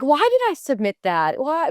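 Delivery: high-pitched, incredulous self-questioning; mock dismay. Retells her dismay at bad tape.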